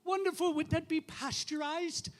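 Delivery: priest's high, wondering question; Father John. Acted. Priest asking a parishioner about her plan, high wondering voice. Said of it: "Father John looked at her and he said".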